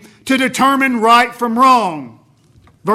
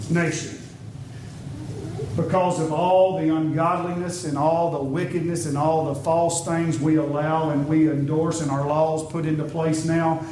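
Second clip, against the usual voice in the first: much softer and lower, solemn; softer and lower, heavy with sorrow; hushed grave sermon; 5 semitones lower, 7 dB quieter than usual. Real, not acted. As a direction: drop to a hushed, grave voice as if passing sentence. Preacher indicts the nation gravely.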